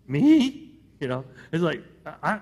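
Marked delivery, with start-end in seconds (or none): 0.1-0.8 surprised. Retold or imitated. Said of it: "you imagine Joshua's like".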